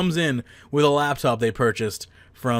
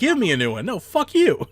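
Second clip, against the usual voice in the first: mock outburst; 7 semitones higher than usual. Mock customer and clerk.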